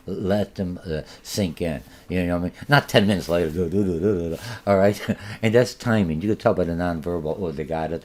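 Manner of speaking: normal talking voice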